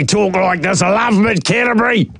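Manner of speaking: high-pitched caricature of a talkback texter; Donny Bell impression. Radio host guessing how Mad Mike talks, high-pitched impression. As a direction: put on a high character voice for the texter. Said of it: "sounds like a Donny Bell impression".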